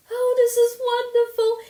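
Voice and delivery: very high, loud ecstatic cry; rapturous outburst. A narrator's reading. Anne rejoicing that Diana loves her, ecstatic cry. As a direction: burst out with joy.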